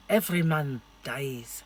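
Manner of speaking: movie line in a bad Scottish accent; a lightly put-on Scottish accent; attempted Scottish accent. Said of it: "Sorry to my Scottish people".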